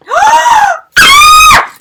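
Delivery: high, very loud shriek of surprise; screamed gasp. Real, not acted. Fan who just got a hat, screamed gasp.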